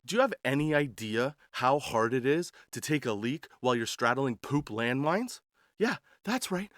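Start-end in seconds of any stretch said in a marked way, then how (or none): none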